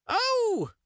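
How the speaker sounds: very high, loud witch howl; horrible howl. Acted. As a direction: shriek as the oven swallows her. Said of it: "began to howl quite horribly".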